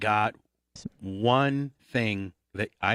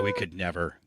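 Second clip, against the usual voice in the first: ordinary delivery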